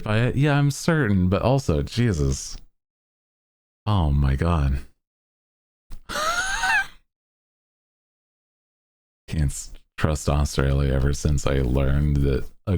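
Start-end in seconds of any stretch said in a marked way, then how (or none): 5.9-6.8 high, soft squeal of laughter. Real, not acted. Laughing at the venomous snake.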